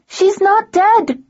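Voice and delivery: high, loud, slow yell near tears; girl yelling on the verge of tears; tearful yell. A narrator's reading. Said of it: "Dare yelled, sounding like she was on the verge of tears".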